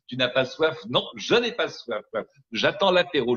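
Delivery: normal talking voice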